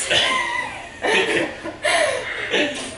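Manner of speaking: high shrieking burst of laughter. Real, not acted. Pose collapses into laughter.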